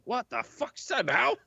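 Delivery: high, incredulous yokel voice; npc shock. Acted. A guard gawping at an owl in the chimney, high and incredulous. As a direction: squawk it out in disbelief.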